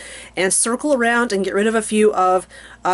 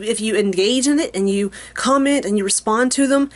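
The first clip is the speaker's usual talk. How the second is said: ordinary delivery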